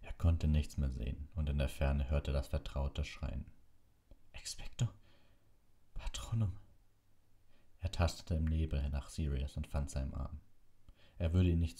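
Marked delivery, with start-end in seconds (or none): 4.3-6.5 faint unvoiced whisper; no strength left; whispered spell. A narrator's reading. Harry, blinded by fog, whispers the spell.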